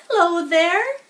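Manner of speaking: put-on a young pig says hello, eager and bright-eyed; Wilbur the pig, friendly. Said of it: "he said".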